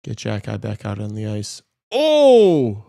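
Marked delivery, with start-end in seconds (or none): 1.9-2.8 sudden high, loud Oh!; a yelp of delight at the big hit; excited oh. Real, not acted. Streamer yelps at the hit on screen, excited.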